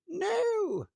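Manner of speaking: high, loud frightened no; witch crying no. A narrator's reading. Witch fleeing the dragon, a loud alarmed cry. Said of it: "cried the witch".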